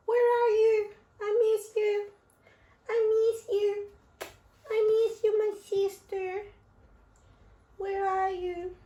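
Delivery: very high, loud squeaky pet voice pleading; ferret missing sister. Pretend. Owner voicing ferret missing her sister, squeaky high plea. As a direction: cry out as the lonely little ferret.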